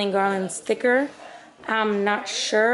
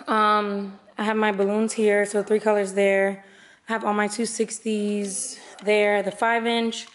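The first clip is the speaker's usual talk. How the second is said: ordinary delivery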